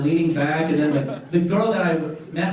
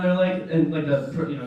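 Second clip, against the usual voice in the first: ordinary talk, nothing marked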